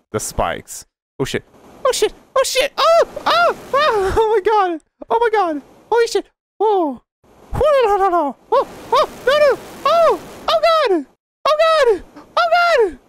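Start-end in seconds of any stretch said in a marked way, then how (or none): 1.9-13.0 high squealing panic, over and over; panicked shrieks. Real, not acted. Gamer shrieking at the spikes in panic. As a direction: shriek in real terror.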